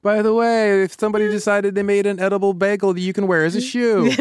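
spring the twist with a grin; sudden twist voice. Retold or imitated. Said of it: "And then all of a sudden".

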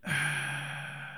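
long pondering exhale; sigh. Real, not acted. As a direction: breathe out slowly while weighing the answer. Pondering the co-lead question.

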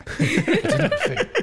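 slow words through laughter; laughing. Real, not acted. Embarrassed admission.

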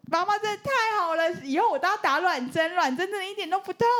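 put-on high, delighted kid voice; lightly voiced thrilled child; excited child. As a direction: gush like a happy little kid. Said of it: "我就说".

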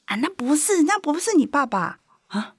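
high, emphatic uncle imitation; neighbourhood uncle. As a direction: correct the child like a meddling local elder. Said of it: "就我们那附近的阿伯告诉我".

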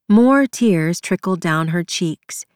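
ordinary delivery, nothing marked

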